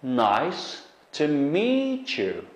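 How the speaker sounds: Nice to meet you, drawn out with weight on meet; slow teacher demo, meet stressed; slow model phrase. Shown as a demonstration. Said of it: "Endi bu qarasanglar".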